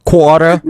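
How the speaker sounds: "quarter" without its Rs, "kwah-tuh"; Louisiana "quarter". Shown as a demonstration. Giving an example of Louisiana words, "quarter" as "kwah-tuh". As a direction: drop both Rs in "quarter". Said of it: "It is quarter".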